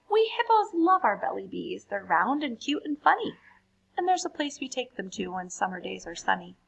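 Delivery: lively, animated poem reading; reading to children. A narrator's reading. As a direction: read it brightly for a young audience. Reads poems to children.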